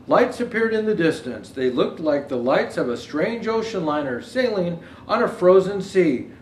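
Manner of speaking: put-on storybook reading for children; gentle read-aloud to kids; story narration. Reads picture book aloud.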